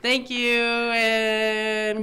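slow, loud goodbye through laughter; can't stop laughing while wrapping up; laughing sign-off. Real, not acted.

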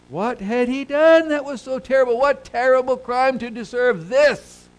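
very high, loud outraged question; incredulous, full-force rhetorical appeal; impassioned preaching. Real, not acted. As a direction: cry out in protest from the pulpit.

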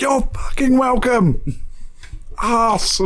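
high, slow, sarcastic yell; road rage shout. Retold or imitated. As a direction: snap it at a rude driver. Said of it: "I do shout quite a lot when I'm in the car".

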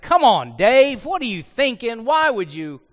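scolding parent. Retold or imitated. Parent to child caught in trouble, mock scolding. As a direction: imitate a parent telling off a child. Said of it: "that voice that mom and dad would say".

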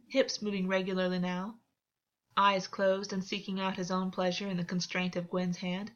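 ordinary delivery, nothing marked

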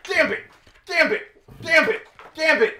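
high, loud battle-cry yell. Pretend. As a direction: yell it out like an attack move. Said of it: "Well, you stop yelling".

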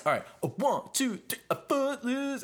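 high sing-song count-in; mock count-in. Bandleader counting the orchestra into Footloose, high and sing-song.

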